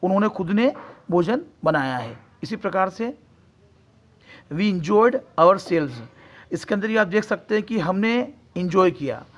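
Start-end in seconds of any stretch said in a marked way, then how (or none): 4.5-5.9 every word stretched slowly; slow dictation. Shown as a demonstration. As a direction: spell it out slowly for students. (धीरे-धीरे पढ़ाया गया वाक्य)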